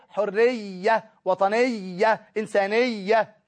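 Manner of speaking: slow "حرية، وطنية، إنسانية" with a long, geminated y in each; very drawn-out "-iyya" endings on "حرية، وطنية، إنسانية"; doubled ya. Shown as a demonstration. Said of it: "لازم الياء تبقى مشددة".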